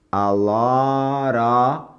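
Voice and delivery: "A lot of" slow, with the T of lot as a soft tapped R. Shown as a demonstration. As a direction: say "A lot of" slowly, T like a light R. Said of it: "Esse T ... som de R fraquinho". ("A lot of" devagar, com o T como um R fraquinho)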